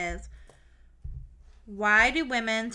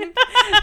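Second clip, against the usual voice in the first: sudden high, loud laughter; 14 semitones higher, 11 dB louder than usual. Real, not acted. Telling friend the STD excuse, laughing hard. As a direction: burst out laughing at yourself. Laughing at own clinic story.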